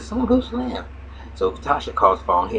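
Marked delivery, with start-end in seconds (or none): 0.0-0.8 higher put-on woman's voice, surprised; mother's voice. Retelling the show, he voices Tasha asking who Slim is in a high pitch. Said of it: "She said".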